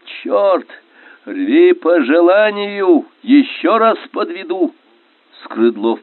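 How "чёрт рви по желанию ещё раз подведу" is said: high, drawn-out yell in battle; urgent shouting at a raised pitch; shouted cry. A narrator's reading. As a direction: yell it high across the boat. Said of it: "крикнул он".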